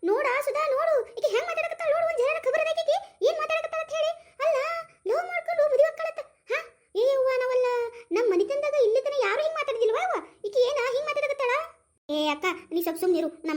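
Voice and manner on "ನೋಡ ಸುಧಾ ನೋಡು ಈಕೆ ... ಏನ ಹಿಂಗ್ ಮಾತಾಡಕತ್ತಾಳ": very high-pitched scandalized protest; acted scandalized mother, voice pitched way up; outraged complaint. Mother outraged by talk of love marriage.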